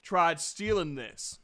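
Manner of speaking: loud, emphatic storytelling voice. Acted. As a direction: announce it with gusto. Same character.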